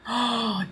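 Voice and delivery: sharp gasp of realisation. Real, not acted. Sudden realisation about father.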